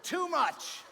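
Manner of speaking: big loud shout on TOO, falling; shouted too much. Acted. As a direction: yell it with everything. Comic contrast of joke reactions.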